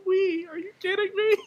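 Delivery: high, far incredulous cry. Retold or imitated. Recalling crying in the movie, a high incredulous squeal. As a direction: voice cracking up in mock outrage. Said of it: "I was like".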